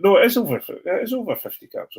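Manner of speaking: high, loud self-correction; self-correcting, exasperated. Real, not acted. Podcast host correcting his own maths, exasperated. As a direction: argue with yourself over the numbers. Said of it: "having an argument with maths".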